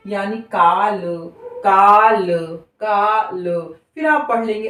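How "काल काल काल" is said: "काल" stretched out very slowly, letter by letter; slow reading demo. Shown as a demonstration. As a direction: drag out "काल" slowly, then say it once more. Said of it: "इसी निरंतरता के साथ आप पढ़ेंगे". ("काल" को बहुत धीरे खींचकर पढ़ना)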